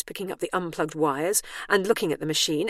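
ordinary delivery

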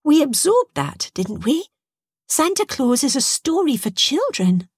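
put-on high-pitched childlike voice; child creature. Young jellyfish child voiced in story.

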